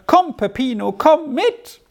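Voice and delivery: high, drawing-in crowd call; calling from afar. A narrator's reading. Passing friends in the crowd to Peppino, high calling. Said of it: "tönte es einmal aus dem Gedränge herüber".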